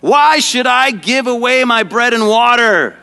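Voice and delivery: high, indignant shouted refusal; mock shout. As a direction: act out an outraged shout for others to copy. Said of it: "he shouted".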